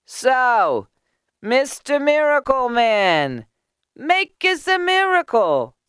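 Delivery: high, loud challenge; high, drawn-out heckling call. A narrator's reading. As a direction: taunt him from the back of the crowd. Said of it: "someone called out".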